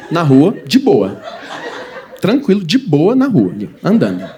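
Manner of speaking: lazy slow stroll through the words; slow dreamy drawl. Pretend. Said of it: "slowed repeat of de boa".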